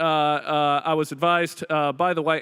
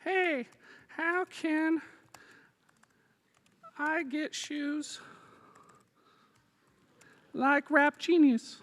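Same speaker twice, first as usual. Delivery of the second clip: much higher and slow, sing-song goofy voice; silly made-up voice; 9 semitones higher, 7 dB quieter, much slower than usual. Pretend. Presenter voicing his demo text to the crowd, high goofy drawl. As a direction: voice the text message like a silly character. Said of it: "I'm gonna be like".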